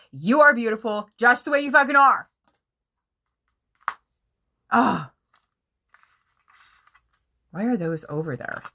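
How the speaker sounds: usual speaking voice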